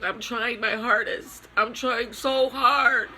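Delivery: high, tearful voice breaking through sobs; real grief pouring out, voice cracking upward; crying. Real, not acted. Grieving mother breaks down crying.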